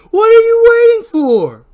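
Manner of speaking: very high, very loud and slow, drawn-out shriek; slow spinning scream. Acted. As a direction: stretch the scream out as she spins. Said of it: "She adds a spin with an overhead shot as she repeats".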